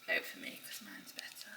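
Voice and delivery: hushed, secretive vote plea; playful stage whisper to the viewers; conspiratorial whisper. Pretend.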